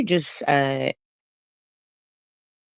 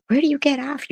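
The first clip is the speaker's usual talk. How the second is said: shrieking much higher, furious; furious yell. Real, not acted. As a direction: scream it at her. Heated streamer argument.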